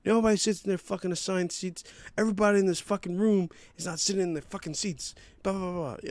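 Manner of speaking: high, indignant mock rant; imitating irate moviegoer. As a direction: rattle off a complaint in a worked-up high voice. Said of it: "this is how he's going".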